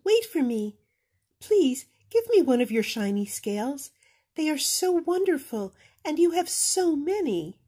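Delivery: high, pleading little voice; eager little fish. Acted. Little blue fish begging Rainbow Fish for a scale, high. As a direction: beg sweetly for one scale.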